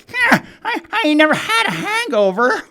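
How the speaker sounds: high, put-on old-timer boast; old guy boasting. Old guy at podium bragging, high put-on voice. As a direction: play the bragging old drunk. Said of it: "old guy's up at the podium going".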